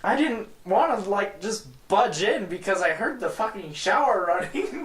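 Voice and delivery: high, awkward explaining friend; Zach impression. Friend explains why he came in, high sheepish Zach. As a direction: lift the voice and sound sheepish like Zach. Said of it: "Your Zach impression is fantastic".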